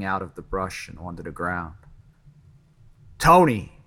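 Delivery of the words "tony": loud, high shout of a name; scream. A narrator's reading. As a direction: call out sharply to get his attention. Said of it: "Jimmy screamed".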